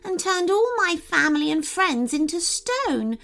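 high, small fairy voice; sad little fairy. A narrator's reading. Fairy telling of her village's curse, sad high voice.

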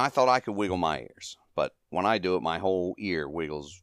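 normal talking voice

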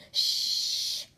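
long voiceless shushing; whispered shush. A narrator's reading. Mater hushing Lightning past sleeping bulldozer, whispered shush. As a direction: shush a friend with a drawn-out hiss. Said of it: "whispered Mater".

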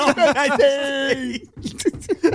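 high, loud, drawn-out pleading cry; mock eagerness. Players clamouring for the starting side, mock eagerness. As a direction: whoop it slowly like an over-keen player.

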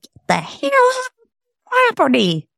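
put-on high-pitched, hateful Southern US accent; deep Southern accent. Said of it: "deep Southern accent".

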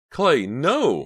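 high, loud horrified shriek; panicked long cry. A narrator's reading. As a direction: shriek to stop him. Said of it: "Mickey shrieked from right beside her".

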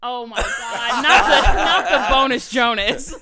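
loud laughing outrage; laughing protest. Real, not acted. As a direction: cry out in laughing disbelief. Horrified laughing protest at Frankie.

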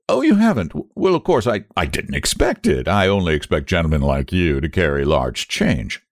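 sneering clerk, mock politeness; sarcastic. A narrator's reading. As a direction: lay on the sarcasm. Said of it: "worked up the most sarcastic expression of countenance and said".

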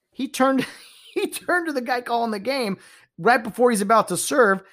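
ordinary talk

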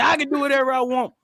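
fast, high, loud mock retort; defiant old man. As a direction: imitate an old man's stubborn outburst. Old man's retort retold.

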